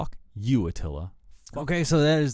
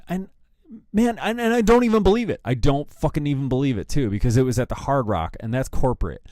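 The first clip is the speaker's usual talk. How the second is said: normal talking voice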